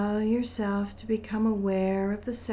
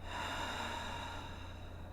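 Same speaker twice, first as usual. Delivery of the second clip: slow meditative breath, very soft; soft airy sigh; long audible breath; 14 dB quieter than usual. Shown as a demonstration. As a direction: let out a long, quiet breath. Breathing during guided meditation.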